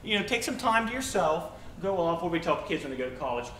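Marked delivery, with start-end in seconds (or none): 0.0-2.2 high, loud mock-casual advice voice. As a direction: parody the careless advice, pitched up. Mocking worldly advice to kids.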